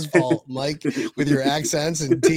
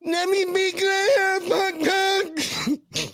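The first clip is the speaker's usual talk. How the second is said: very high, drawn-out goofy impression; high, slow, mocking caricature; Ryan Hickman; 14 semitones higher, much slower than usual. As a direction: squeal it slowly in a silly put-on voice. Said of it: "What would Ryan Hickman sound like?".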